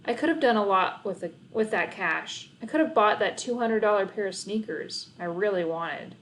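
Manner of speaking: ordinary delivery, nothing marked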